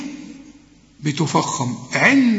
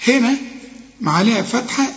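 ordinary delivery

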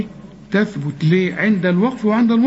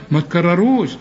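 ordinary delivery

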